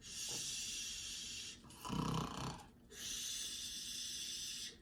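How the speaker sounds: long breathy hush; hushing shh. Acted. Teacher hushing the class after the bedtime story. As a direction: hush them like a sleeping baby.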